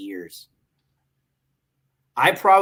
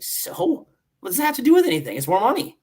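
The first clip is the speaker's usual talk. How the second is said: dismissive retort; 10 semitones higher, 4 dB louder than usual. Retold or imitated. Acting out retort to being promoted.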